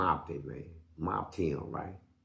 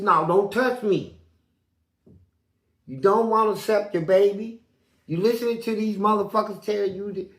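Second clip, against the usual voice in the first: scolding; 6 semitones higher, 6 dB louder than usual. Retold or imitated. The inmate pushing him away, loud and scolding.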